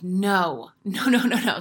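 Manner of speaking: a mock outburst of denial at the knee pain; rising no, no, no. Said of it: "And I'm like".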